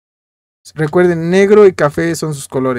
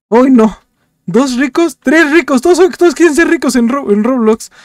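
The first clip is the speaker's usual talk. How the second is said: mounting dismay; 7 semitones higher than usual. Real, not acted. Counting rich players, rising alarm.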